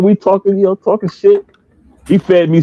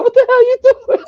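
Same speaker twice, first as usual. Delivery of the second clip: high, laughing what-the-hell; laughing stranger. Retold or imitated. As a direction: act the confused stranger through laughter. Voicing startled stranger, laughing.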